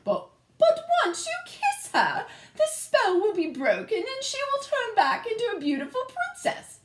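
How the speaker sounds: very high, coaxing queen voice; the queen urges the prince to kiss her, very high; eager queen. A narrator's reading. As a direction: coax the prince sweetly as the queen. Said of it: "added the queen".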